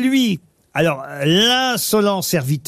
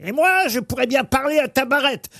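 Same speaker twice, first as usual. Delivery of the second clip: lightly voiced grumpy old man; a touch of an irritated old miser; Harpagon old man voice; 7 semitones higher than usual. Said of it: "je vais vous faire le vieux pour Harpagon".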